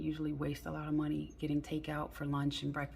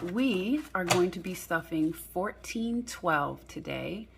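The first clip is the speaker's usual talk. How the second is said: ordinary delivery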